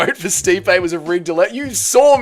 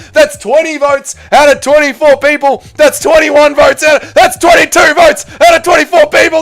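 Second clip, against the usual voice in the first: loud, high auctioneer patter; 8 semitones higher, 5 dB louder than usual. Acted. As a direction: call out the vote counts like an auctioneer. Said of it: "You sound like an auctioneer".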